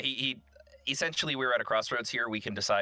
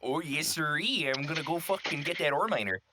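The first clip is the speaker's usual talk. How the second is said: mock Minnesota accent; 7 semitones higher than usual. Player leaning into the teased voice, hammed-up Minnesota accent. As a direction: play along in a broad put-on Minnesota accent. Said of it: "you sounded like you're from Minnesota".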